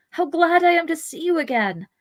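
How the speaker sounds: so glad to find a lost friend; glad relief. Acted. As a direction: greet him with bright relief. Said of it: "Pippin glad to see Merry".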